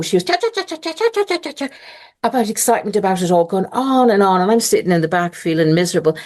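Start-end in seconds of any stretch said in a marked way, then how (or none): 0.0-1.7 high rapid cha cha cha, mimicking a gushing mother; mock her breathless gabbling, pitched high; hammed-up high-pitched chattering; imitated excited chatter. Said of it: "she was up to high dough".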